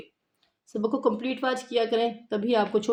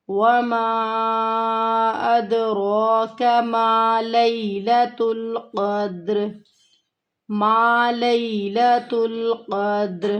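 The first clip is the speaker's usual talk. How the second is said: slow, drawn-out tajweed chanting; Quran recitation; much slower than usual. Shown as a demonstration. Teacher chanting the verse slowly for her students. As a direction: recite each word long and measured.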